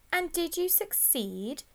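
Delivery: high, slow, sneering question; slow, mocking teacher's probe; nasty smile. A narrator's reading. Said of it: "with a nasty smile".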